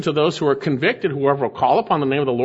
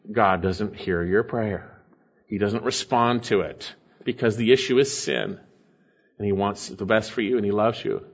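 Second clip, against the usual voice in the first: tender and low; 9 semitones lower, 5 dB quieter than usual. Real, not acted. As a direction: lower and softer, gentle reassurance. Preacher turns tender about God's love.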